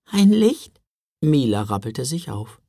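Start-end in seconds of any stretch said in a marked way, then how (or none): 0.0-0.8 surprised question. Acted. Sister asks back hopefully.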